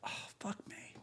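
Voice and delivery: muttered under the breath; whispered curse. Real, not acted. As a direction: whisper it in frustration.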